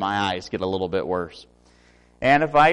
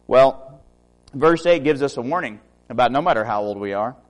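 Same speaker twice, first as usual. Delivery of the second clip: ordinary delivery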